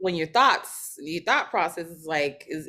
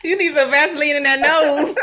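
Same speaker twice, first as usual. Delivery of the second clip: laughing; 8 semitones higher, 10 dB louder than usual. Real, not acted. Joking, laughing.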